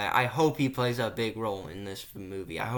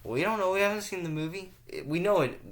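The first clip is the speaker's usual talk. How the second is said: excited high voice; 9 semitones higher, 4 dB louder, faster than usual. Real, not acted. Excited speculation.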